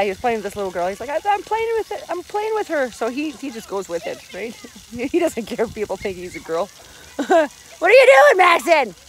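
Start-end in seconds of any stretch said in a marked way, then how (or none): 1.1-2.9 high, childlike voicing of her son; imitating a little boy, pitched up; high kid voice. Dad voicing his son playing with a girl, high childlike voice. 7.2-8.9 loud high call across the splash pad. Real, not acted. Mom calling to her son in the pool, loud call.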